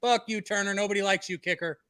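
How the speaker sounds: high taunting yell at the kicker; rowdy fan heckling; frat bro. Real, not acted. Cheering missed kick, taunting kicker.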